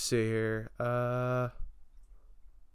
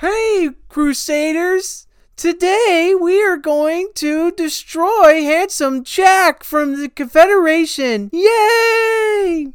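put-on high childish voice; 9 semitones higher than usual. Tiny Tina voice.

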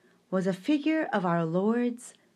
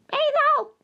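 very high, loud call; shout; 8 dB louder than usual. Pretend. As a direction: call out the name with glee. Shouted name.

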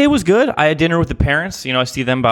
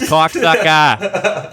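drawn-out cuss; 4 semitones higher, much slower than usual. Pretend. Cussing for effect.

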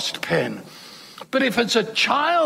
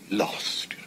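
Lost breathed out in a soft, unvoiced sneer; 9 dB quieter than usual. Shown as a demonstration. Preacher mocking how some Christians say Lost, sneered. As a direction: sneer the word Lost with contempt. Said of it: "Gotta sneer it out".

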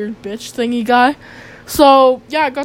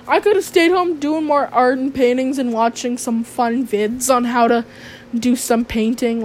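usual speaking voice

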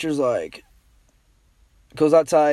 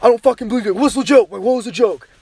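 angry dad; 5 semitones higher, 3 dB louder, faster than usual. Retold or imitated. Angry dad demanding the joke.

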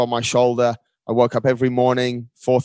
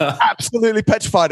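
laughing; 9 semitones higher than usual. Real, not acted. Laughing while recalling his fear.

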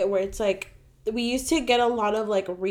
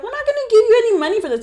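higher, louder, rushed fast; exasperated refusal; 12 semitones higher, 6 dB louder, much faster than usual. Retold or imitated. Cashier refuses a customer's crib refund, loud and fast. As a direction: fire it off fed up.

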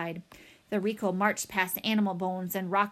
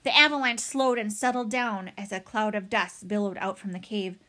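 ordinary delivery

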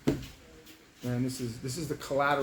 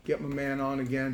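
usual speaking voice

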